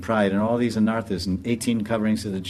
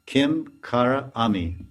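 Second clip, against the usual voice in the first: slow spelling out; slower than usual. Shown as a demonstration. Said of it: "Kinkari means".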